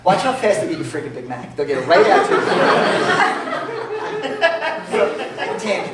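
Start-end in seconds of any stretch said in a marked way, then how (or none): 2.5-5.5 long high laugh. Real, not acted. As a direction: laugh it off hard. Laughing at own tangent.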